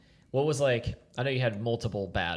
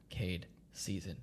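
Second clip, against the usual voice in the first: quiet reminder; 4 semitones lower, 11 dB quieter than usual. Retold or imitated. Quoting himself while cooking.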